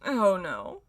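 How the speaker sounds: ordinary delivery, nothing marked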